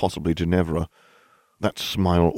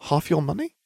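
skeptical; 10 semitones higher than usual. Acted. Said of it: "skeptically asking".

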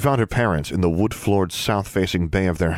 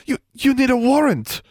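very high, stammered demand; flustered protest; 4 dB louder than usual. A narrator's reading. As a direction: stumble into an indignant objection. Wife challenges the arresting officers.

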